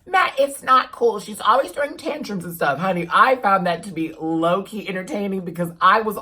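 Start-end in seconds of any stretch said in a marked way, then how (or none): 0.0-2.8 mock scolding fan. Viewer scolding the vlogger, high and loud. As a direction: scold in a raised, put-on voice. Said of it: "some of y'all are probably like".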